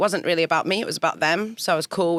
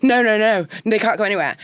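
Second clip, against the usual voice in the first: louder, higher panicked no, no, no; alarmed refusal; 5 semitones higher, 4 dB louder than usual. Retold or imitated. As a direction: recoil from the request in a raised panicky voice. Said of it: "And I was like".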